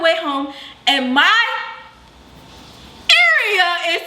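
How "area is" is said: laughing through the words; can't keep a straight face; giggling as the itch comes back. Real, not acted. Laughing while retelling the itch.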